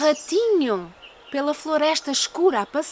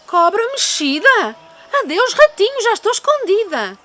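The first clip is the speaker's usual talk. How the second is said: high, loud, panicked snake; frightened snake; 7 semitones higher, 4 dB louder than usual. Acted. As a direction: gasp and slither off in fright.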